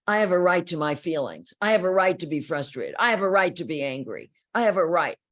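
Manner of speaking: mock the wounded client demanding her rights; indignant insistence. Client insisting to the partner on her rights, loud indignant mock.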